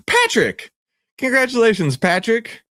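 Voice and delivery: high excited announcement; cheerful call. Real, not acted. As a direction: call out the winner. Announcing winner.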